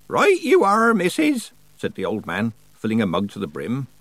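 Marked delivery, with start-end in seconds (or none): 0.1-1.5 put-on high, loud old rustic; cheery old countryman. A narrator's reading. Old man accepts Nanny's promise, cheerily. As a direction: chirp agreement like an old man.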